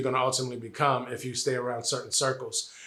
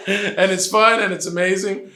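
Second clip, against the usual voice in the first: said through laughter, high and loud; real laughter through the words; laughing; 8 semitones higher, 10 dB louder than usual. Real, not acted. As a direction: keep laughing as you say it. Still laughing after joke.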